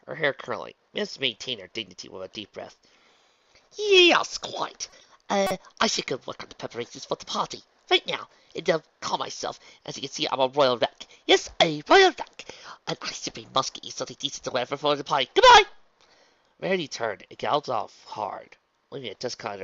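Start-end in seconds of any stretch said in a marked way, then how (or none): 3.8-15.6 high, loud, theatrical fluster; flustered excuses. Acted. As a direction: babble excuses, shrieking up on key words. Flustered Rarity making a hasty exit.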